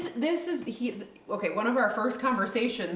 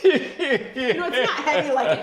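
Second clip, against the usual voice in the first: talking through laughter; laughing; 6 semitones higher, 6 dB louder than usual. Real, not acted. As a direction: laugh while you say it. Laughing while praising the dessert.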